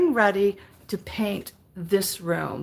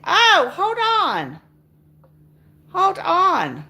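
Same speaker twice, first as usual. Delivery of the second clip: surprised; 9 semitones higher, 6 dB louder, slower than usual. Real, not acted. Tech glitch during live call.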